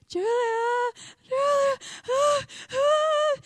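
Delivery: imitated hysterical wail, very high and loud; retold wailing. Distraught girl clinging to the volunteer, imitated loud wailing. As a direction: wail the name out, stretched and high. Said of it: "And she is wailing".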